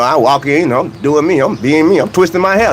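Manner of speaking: usual speaking voice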